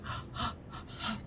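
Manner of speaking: sharp breathy shocked gasp; imitating a horrified gasp; mock gasp. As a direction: gasp like you've seen a ghost. Staff react to bloody clothes.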